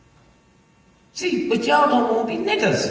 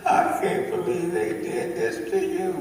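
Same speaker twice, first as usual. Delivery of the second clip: wife crying; 5 semitones lower, 4 dB quieter than usual. Retold or imitated. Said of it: "and she started crying".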